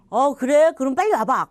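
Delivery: delighted "oh really?"; surprised. Retold or imitated. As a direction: perk up at the good news on the phone. Reacting to friend's phone call.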